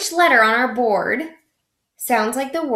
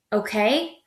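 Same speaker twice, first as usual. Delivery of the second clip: usual speaking voice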